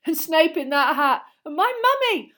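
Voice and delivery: high, loud gleeful chatter; shrieking enthusiasm after class; excited pupils. A narrator's reading. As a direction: chime in breathlessly keen, rising to a squeal. Pupils excitedly recount the lesson.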